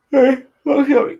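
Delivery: words stretched by a big yawn; talking through a yawn. Real, not acted. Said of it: "cast up yawning, I do apologise".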